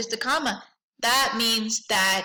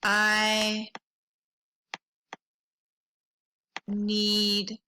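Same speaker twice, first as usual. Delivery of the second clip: slow dictation; 3 semitones lower, much slower than usual. Shown as a demonstration. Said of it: "And I would say".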